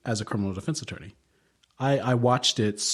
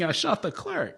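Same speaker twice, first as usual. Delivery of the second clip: flat confession; 8 semitones higher, 3 dB quieter than usual. Retold or imitated. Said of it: "the way it comes out on paper".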